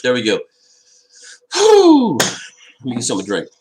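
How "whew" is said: high whistling whew of relief; phew, finally done; relieved whew. Real, not acted. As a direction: blow out a big high whew. After drawing map, needs drink.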